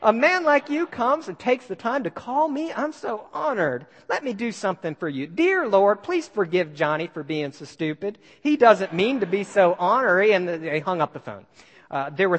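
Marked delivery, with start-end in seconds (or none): 0.0-10.3 mock-sweet reply. Watts answers threatening call sweetly.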